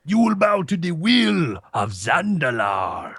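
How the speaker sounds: slow, ominous boss voice; Hekima the Wise. Retold or imitated. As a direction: decree it like a doom prophet. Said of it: "where he's like".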